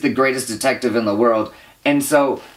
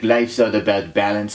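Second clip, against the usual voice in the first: mock wise detective; 6 semitones lower than usual. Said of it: "He's like".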